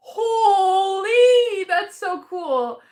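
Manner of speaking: high, drawn-out amazement; awestruck delight. Real, not acted. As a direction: stretch it out, thrilled and nearly tearful. Thrilled by viewer's postcard.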